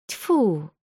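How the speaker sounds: disgusted "tfu"; scornful spitting noise; old woman's spit. A narrator's reading. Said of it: "плюнула старушка".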